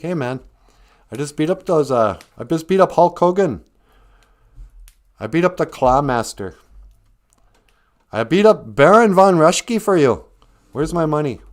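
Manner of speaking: put-on loud, pushy kid voice; a kid shouting at the promoter for his pay; demanding kid. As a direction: demand your money, rising to a yell.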